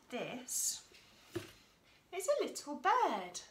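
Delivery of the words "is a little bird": bright high voice, louder, showing the bird; high excited reveal. Shown as a demonstration. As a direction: lift the voice high for the little bird. Teacher presenting bird to children.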